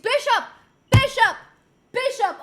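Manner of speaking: loud, high shout of discovery; excited shout. Real, not acted. Streamer shouting the answer at chat, excited. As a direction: yell it out like you just solved it.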